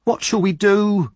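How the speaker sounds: high, loud, slow wail; helpless panic; distressed cry. A narrator's reading. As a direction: mistress crying out in alarm. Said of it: "cried Missus Sowerbury".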